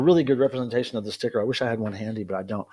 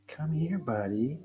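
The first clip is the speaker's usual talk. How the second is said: hushed, gentle pet call; very soft coaxing, almost under the breath; 11 dB quieter, slower than usual. Real, not acted. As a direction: softly tempt a wary cat closer. Softly coaxing cat over.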